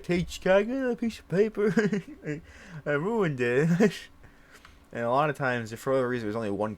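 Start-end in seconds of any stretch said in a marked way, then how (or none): 0.0-3.9 put-on higher, sheepish little-kid voice. Kid asks teacher for new paper, high sheepish voice. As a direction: ask the teacher like a kid who messed up. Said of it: "I'd be like".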